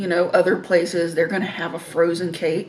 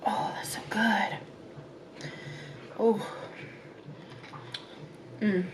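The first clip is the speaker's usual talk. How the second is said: whispering; 13 dB quieter than usual. Real, not acted. Savoring a bite of cake.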